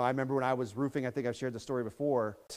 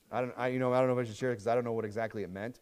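ordinary delivery

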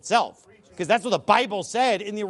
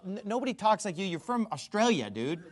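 usual speaking voice

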